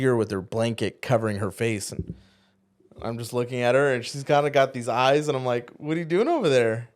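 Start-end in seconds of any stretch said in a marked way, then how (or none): 5.8-6.9 higher-pitched teasing sweet talk, retold; re-enacted cutesy flirty question; playful flirting. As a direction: tease her lightly, pitch lifted. Retold flirting with his wife.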